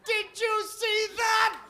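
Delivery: high, loud, slow shout; shouting to the theatre mid-film; yelled. Pretend. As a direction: bellow it over the movie. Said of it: "you say".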